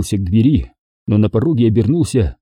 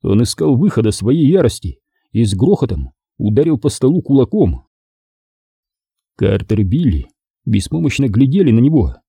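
ordinary delivery

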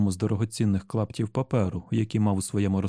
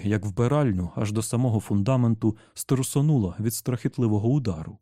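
ordinary delivery

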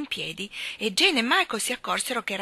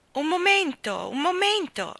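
voce alta e affannata, senza fiato; ansante; 10 semitones higher, 5 dB louder than usual. A narrator's reading. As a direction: chiama trafelata, molto più acuta. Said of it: "esclamò una voce ansante". (grido affannato e acuto)

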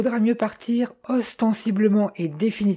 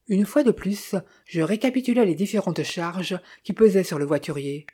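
plain reading voice

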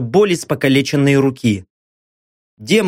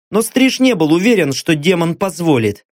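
usual speaking voice

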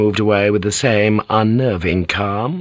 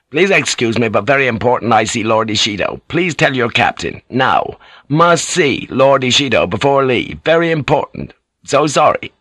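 insistent, polite plea; 3 semitones higher than usual. Acted. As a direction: keep pressing politely, stressing each key word. Blackthorne insists on seeing lord.

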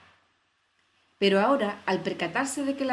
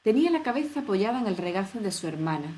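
ordinary delivery, nothing marked

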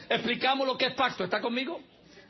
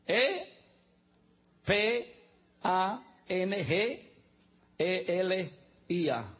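spelling it out; much slower than usual. Shown as a demonstration. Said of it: "es la palabra evangelia".

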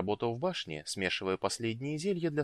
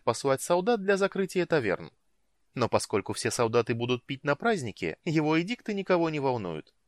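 higher, animated character voice; lively, enthusiastic woman; 5 semitones higher, 5 dB louder than usual. A narrator's reading. Woman tells the mage about the festival, lively and enthusiastic. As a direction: talk up the party with relish.

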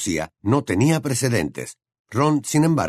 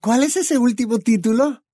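put-on much higher, louder girl's voice; high, bright young witch voice; Hermione's voice; 14 semitones higher, 3 dB louder than usual. A narrator's reading. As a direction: voice the clever girl friend.

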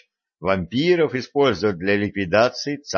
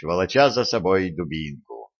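plain reading voice

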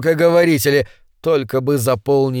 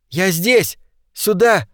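higher, distant cry; shouting; 5 semitones higher, slower than usual. A narrator's reading. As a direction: cry out for help. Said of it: "пробился чей-то крик". (далёкий крик)

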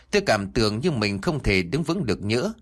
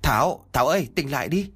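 calling out; 5 semitones higher than usual. Acted. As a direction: call her to wake up. Waking fainted colleague.

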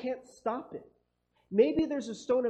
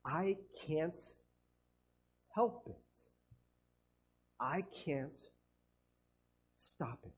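softer, halting, defeated; quiet, broken-up helplessness; helpless admission; 3 semitones lower, 7 dB quieter, slower than usual. Retold or imitated. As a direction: confess you're powerless, trailing away. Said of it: "we look at the person and we say".

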